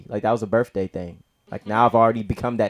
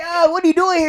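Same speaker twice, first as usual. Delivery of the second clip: very high, loud surprise; excited question; 6 dB louder than usual. Retold or imitated. Asking his cousin why he's on campus. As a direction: ask it bursting with excitement.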